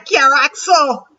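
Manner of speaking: put-on slow Caribbean accent, "Axel" dropped to "Axo". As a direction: lean into a Caribbean lilt, slow. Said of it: "I gotta say it with an accent".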